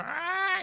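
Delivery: high drawn-out door creak; imitated creaking door. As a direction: imitate a door creaking open in one long high squeal. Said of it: "you know, the door opens".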